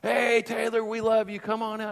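high-pitched mock cheer; hammed-up fans hailing a friend; imitated cheering crowd. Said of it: "the men would just explode into applause".

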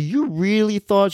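high, slow mock incredulity; retold disbelief. Dad questioning daughter by text, high incredulous retelling. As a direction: question it slowly, pitch way up.

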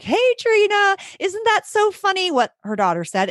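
high-pitched, breezy mom voice; amused mom. Retold or imitated. Mom calling teacher about her daughter's remark, amused. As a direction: imitate a parent finding it all hilarious. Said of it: "she thought it was hilarious".

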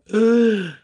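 sharp intake of breath, caught out; a short shocked gasp; retold guilty gasp. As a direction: gasp as if caught red-handed. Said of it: "And I was like".

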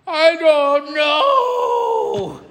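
high, very slow mock wail; tearful child. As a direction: stretch it out like a sobbing little girl. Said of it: "And tears".

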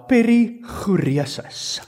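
perichoresis with a rolled Afrikaans r and a throaty ch, slowly; Afrikaans accent. Shown as a demonstration. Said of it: "I'm gonna say it with Afrikaans accent now".

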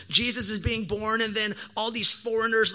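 usual speaking voice

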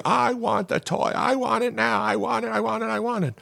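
put-on high-pitched childish whine, I want it over and over; whiny screaming toddler. Preacher mimicking a toddler's tantrum, high childish whine. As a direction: whine like a three-year-old tantrum. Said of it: "The girl is screaming".

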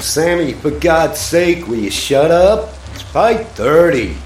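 yelling, high and loud; fed up, shouting through the wall; angry shout. Acted. Yelling at noisy neighbour at dawn.

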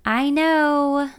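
high, drawn-out whine; whiny. A narrator's reading. Driver giving in to her friend's directions, whining. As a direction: whine it in protest. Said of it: "I whine".

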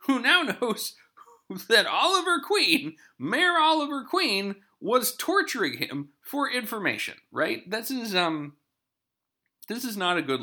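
can you believe this, voice shooting up; incredulous outburst. Real, not acted. Host rants to listeners about the exposed mayor, incredulous and high. Host outraged at the plot hole.

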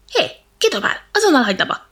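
a cross little monkey shouting; angry shout. Acted. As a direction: yell up at the tree to stop.

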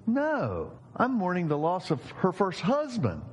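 high, whiny pretend lament; mock wailing. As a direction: act out the mourner's comic anguished protest. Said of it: "wailing and crying".

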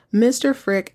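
ordinary talk

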